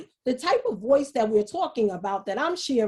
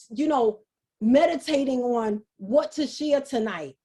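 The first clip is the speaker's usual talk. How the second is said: ordinary delivery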